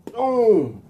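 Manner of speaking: high drawn-out "boom"; impact boom. Acted. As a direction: kick landing as a sound effect. Voicing a kick's impact.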